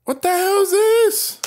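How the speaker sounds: high panicked yelling; frantic cries while fleeing; being chased. Real, not acted.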